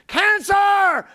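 booming, high shout of CANCER; big loud cancer. Retold or imitated. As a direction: blare it out like a huge threat. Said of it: "it was just this little thing".

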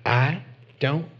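slow, evasive pastor with long pauses; Texas pastor imitation. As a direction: mimic a pastor stalling, word by word. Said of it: "that's a really good imitation of him".

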